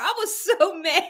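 laughing through the words; half-laughing confession. Real, not acted. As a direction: giggle while admitting it.

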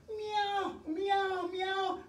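high-pitched playful meowing like a cat; high cat meows. Pretend. Fitness host to class doing cat stretch, high playful meows. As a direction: mew like a cat to cue the stretch. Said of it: "round that back like a cat".

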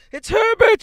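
piercing high, loud scream. A narrator's reading. Mother sure her son is knocking, screaming. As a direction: scream it out wildly as the old woman. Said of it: "she screamed".

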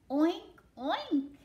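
two loud, high oinks; pig oinking. Acted. Reader plays the pig for children, high oinking. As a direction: oink like a cartoon pig. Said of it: "grunted the pig".

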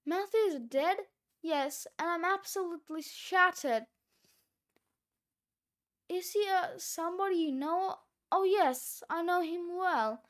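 usual speaking voice

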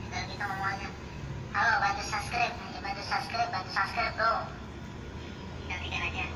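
sped-up squeaky chipmunk effect. Shown as a demonstration. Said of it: "gimana caranya membuat suara tupai".

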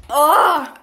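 loud, high pained groan; pained cry. Real, not acted. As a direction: cry out at the burning sourness. Mouth on fire.

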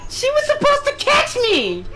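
high-pitched mock-indignant cry; outraged wail. Real, not acted. Complaint after dying in game.

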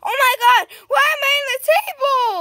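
high-pitched, rushed cry of alarm; playful panicked outcry; exclaimed dismay. Pretend. Pretend sibling finds self under table.

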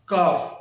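کاف with no trace of L; long vowel in کاف straight to F; silent L. Shown as a demonstration. Teacher repeats the right form, silent L in کاف. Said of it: "لام آپ کو نظر نہیں آ رہا".

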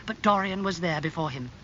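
plain reading voice

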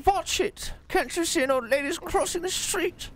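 put-on indignant old lady. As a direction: shriek at the drivers. Old lady scolding drivers.

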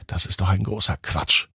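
usual speaking voice

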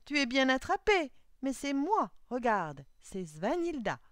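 loud, high, gloating reveal; triumphant mockery. A narrator's reading. Girl reveals herself to fooled suitor, loud triumphant teasing. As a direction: crow it at him loudly.

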